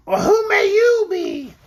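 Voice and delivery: high, loud, drawn-out creature question; Orc character voice. A narrator's reading. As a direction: ask slowly in a high creature voice. Orc questioning the bumpy man.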